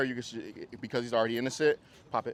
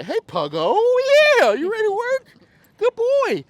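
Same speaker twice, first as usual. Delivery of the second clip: much higher and louder, eager; excited praise; 14 dB louder than usual. Shown as a demonstration. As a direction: pump the dog up before work. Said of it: "I'm gonna say".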